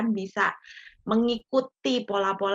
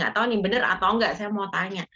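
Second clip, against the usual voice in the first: ordinary delivery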